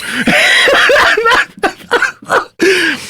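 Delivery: shrieking high laugh. Real, not acted. Host cracks up mid-sentence.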